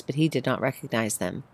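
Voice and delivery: usual speaking voice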